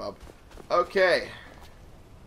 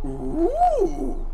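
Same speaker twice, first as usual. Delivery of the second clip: delighted ooh; 6 dB louder than usual. Real, not acted. Discovers he can portal back.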